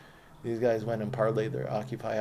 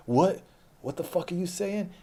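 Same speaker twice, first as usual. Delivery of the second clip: retold challenge, high and raised; confrontational; 7 semitones higher, 7 dB louder, slower than usual. Getting right up to a bully, confrontational. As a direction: throw it in his face, pitched up.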